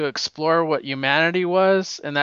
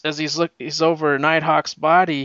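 ordinary talk, nothing marked

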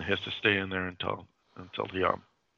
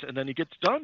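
ordinary delivery

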